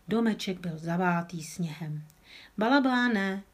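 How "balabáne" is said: calling out. A narrator's reading.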